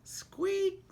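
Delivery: very high mouse squeak; tiny high squeak. Acted. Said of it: "said Mouse".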